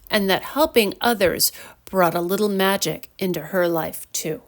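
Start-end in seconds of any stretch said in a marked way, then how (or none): none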